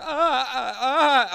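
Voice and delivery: loud, high strained "ah" cries; re-enacted groans of someone desperately holding it in; audible straining groans. Making noises while driving desperate for a toilet, strained groans. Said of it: "I'm making these audible noises".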